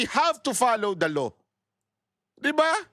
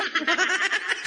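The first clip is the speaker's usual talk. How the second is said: high giggle; high laugh; 9 semitones higher than usual. Real, not acted. Vlogger laughing at the secretary's closed eyes, high giggle. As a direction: laugh at his face.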